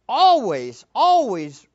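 always, always, punched loud and falling; emphatic repeat. Real, not acted. Preacher pressing his congregation, always stressed hard. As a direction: hammer the word always twice.

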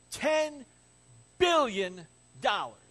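usual speaking voice

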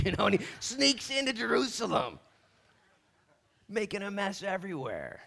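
high, loud, animated storytelling; squawky, over-excited telling; comic outburst. Real, not acted. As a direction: play up the rooster's sneaky mischief loudly. Comically excited tale of renegade rooster.

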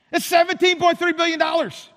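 high-pitched, held-up disbelief; incredulous exclamation. Acted. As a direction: exclaim the absurd figure high, all on one pitch. Mock disbelief at impossible promise.

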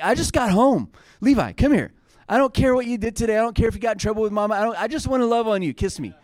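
high, quick, affectionate gushing; doting dad. Retold or imitated. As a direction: coax a small child over for affection. Dad greeting his young son.

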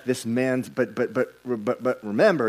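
usual speaking voice

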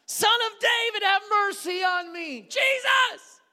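high, loud, drawn-out cries; desperate shouting. Acted. Blind beggar calling to Jesus for mercy, shouting. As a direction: call out across a crowd for mercy. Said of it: "he begins shouting".

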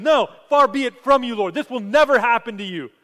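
loud, high, urgent pleading. Acted. Peter pleading with Jesus, loud and urgent. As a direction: beg him not to go, loudly. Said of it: "And so he pleads with him".

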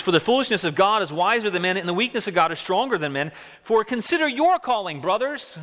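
high, loud scripture proclamation; preaching. Acted. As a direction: proclaim it to the congregation. Quoting scripture with force.

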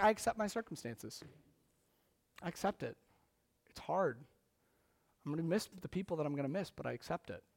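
softly voiced dying patient; quiet acceptance.

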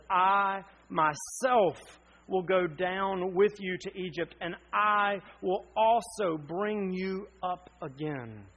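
slow, weighty stress on I myself; God's promise to Jacob, read slowly and emphatically; emphatic promise. Retold or imitated. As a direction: declare it slowly and firmly. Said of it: "emphatically he says".

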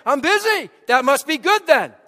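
high, loud mock boast; proud busy churchgoer. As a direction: imitate busy people answering loudly. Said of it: "and we answer".

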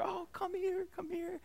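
high, soft and tender; comforting parent. Retold or imitated. Parent comforting hurt child.

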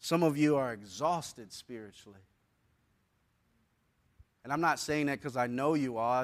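hushed, caring preacher voice; gentle pastoral tone. Acted. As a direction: ease down and comfort the weary. Soft, intimate stretch of sermon.

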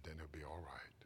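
hushed whispered reassurance; child's whisper. Acted. Little boy whispering to his daddy, hushed whisper. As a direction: breathe it in the dark.

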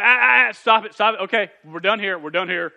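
loud, hammed-up dad call to stop; dad shutting it down. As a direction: shout the kids down like a mock-stern dad. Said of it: "But then I'm going".

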